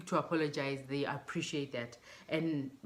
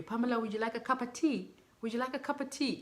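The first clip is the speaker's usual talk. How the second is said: eager tea offer; 7 semitones higher, faster than usual. Retold or imitated. Irish colleague offering tea.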